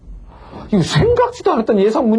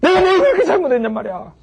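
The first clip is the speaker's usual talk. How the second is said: tearful complaint; 8 semitones higher than usual. Retold or imitated. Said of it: "하는 소리가 막 나오네".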